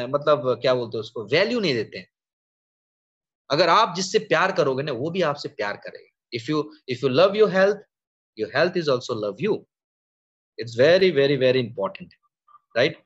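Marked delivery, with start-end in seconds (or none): none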